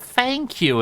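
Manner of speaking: high, mock-grateful thanks; mock thank you. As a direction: squeak out a fake delighted thanks. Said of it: "You're like".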